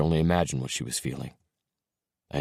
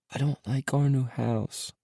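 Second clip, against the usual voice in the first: small hushed voice; 9 semitones higher, slower than usual. A narrator's reading. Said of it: "His voice came out a whisper".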